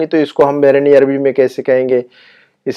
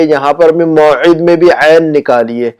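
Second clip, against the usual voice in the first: ordinary talk, nothing marked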